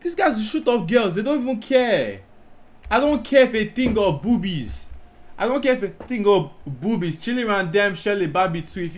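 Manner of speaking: ordinary talk, nothing marked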